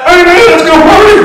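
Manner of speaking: high, loud, hammed-up yell; wild party shout. Man with half a beard answering a customer, wild party shout. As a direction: holler like a crazed reveller.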